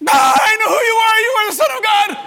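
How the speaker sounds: high, screamed outburst; freaking out. Acted. Possessed churchgoer screaming at Christ in the aisle, freaking out. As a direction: erupt in a loud demonic shriek. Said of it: "Freaking out".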